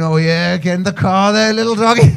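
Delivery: mock dog coaxing. Comedian mimics the dog groomer at work, mock coaxing. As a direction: call a little dog over, teasingly.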